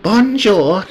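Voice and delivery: put-on high French-accented greeting. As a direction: attempt a French madame's greeting. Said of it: "attempt to do a French accent".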